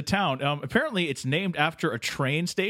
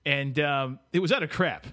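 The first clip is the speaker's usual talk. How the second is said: normal talking voice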